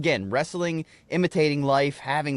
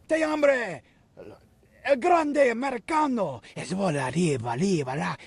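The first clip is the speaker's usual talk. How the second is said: much higher, fast and rolling Mexican Spanish, "Grande" said the Spanish way; fluent Mexican Spanish; 5 semitones higher than usual. Retold or imitated. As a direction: hype the crowd in smooth Mexican Spanish. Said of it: "he would speak Spanish fluently".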